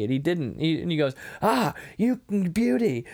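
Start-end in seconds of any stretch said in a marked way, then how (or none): 1.4-3.0 slow, higher mock-Australian cheer of "beauty"; hammed-up Australian Kano; mock Australian accent. Quoting Kano again.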